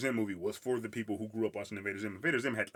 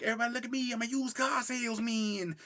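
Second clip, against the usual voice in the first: hammed-up cartoon boy voice, pitched way up; goofy cartoon character voice; 3 dB louder than usual. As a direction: imitate a dopey cartoon boy announcing himself. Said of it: "and he's like".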